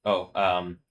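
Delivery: normal talking voice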